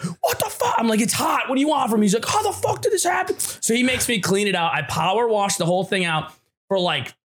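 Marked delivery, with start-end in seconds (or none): 0.0-0.7 very high-pitched mock outburst; freaking-out boss. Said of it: "my boss freaked out". 1.0-2.1 defensive retort. Retold or imitated. Retold protest to angry boss. 2.2-3.4 mock outburst pitched way up; freaking-out boss. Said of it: "fucking losing his mind".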